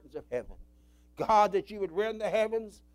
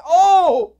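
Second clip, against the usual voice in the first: loud pleading cry, then slow; desperate prayer cry; crying out to God; 6 semitones higher, 14 dB louder, much slower than usual. Real, not acted. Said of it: "I say".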